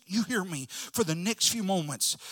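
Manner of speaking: ordinary delivery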